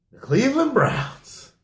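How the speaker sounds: high, drawn-out announcement; mock announcement. Host unveils his bruh of the week, high slow announcement. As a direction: present the team like a big reveal.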